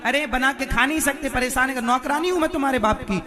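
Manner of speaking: loud, fast scolding rant; mock furious wife scolding; angry wife. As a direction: tell him off in a huff. Wife scolds husband asking food.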